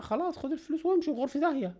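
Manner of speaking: high, hammed-up woman's retort; mock pharmacist voice. Commentator mocks pharmacist telling robber to leave, high hammed-up. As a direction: parody her telling him to get lost.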